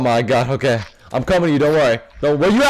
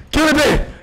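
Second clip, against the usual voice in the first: ordinary talk, nothing marked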